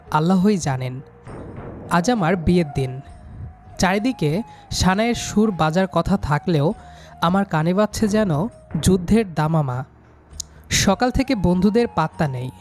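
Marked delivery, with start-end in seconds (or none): none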